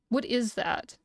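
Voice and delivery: plain reading voice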